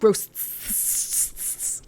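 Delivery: "Roasts" whispered, soft and breathy; whispered echo. Acted. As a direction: hiss the word "Roasts" back like an eerie echo. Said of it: "it is a little Breaking Bad".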